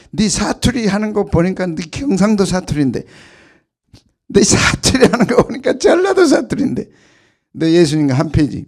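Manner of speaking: loud, rising accusation; accusing girl. Acted. Servant girl calling out Peter's dialect, loud and accusing. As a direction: point the finger at him.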